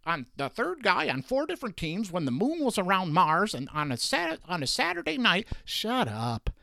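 high, spun-up mockery; mocking exaggeration. Real, not acted. Host ridiculing hyper-specific stats, high and mocking. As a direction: inflate it into a ridiculous stat. Mocking absurd stat qualifiers.

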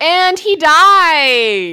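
loud, high, long-drawn proclamation; mock dramatic announcement. Co-host pitching a blunt ending, hammed-up and loud. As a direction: stretch it out like a hammed-up plot reveal. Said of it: "they literally could have just been like".

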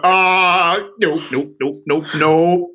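drawn-out high "ah", then slow repeated refusals; retold horror. Reacting to the head rolling off the table, mock horror.

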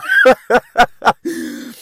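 quick high burst of laughter. Real, not acted. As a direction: laugh at the friend's messed-up head. Laughing at friend's head.

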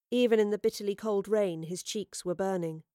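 ordinary delivery

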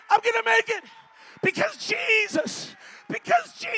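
high, impassioned preacher shout; rallying yell. Shown as a demonstration. Preacher declaring faith for the congregation, high rallying yell. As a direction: yell it out, stretching the last phrase.

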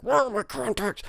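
very high, strained mock scream without real volume; mock freak-out. Mimicking himself hunting for lost contacts, mock freak-out. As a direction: wail it like a hammed-up meltdown. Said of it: "just that guy freaking out".

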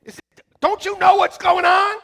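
high loud panicked shout; freaking out. Retold or imitated. Pastor freaking out at wife and son, high loud shout. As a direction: yell at family for not panicking. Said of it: "it's like".

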